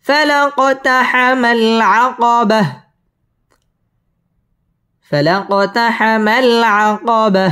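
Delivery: فلا اقتحم العقبة with the qaf bounced and a stop on العقبة; tajweed recitation. Shown as a demonstration. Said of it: "কফ কলকলার হরফ ধাক্কা দিয়ে".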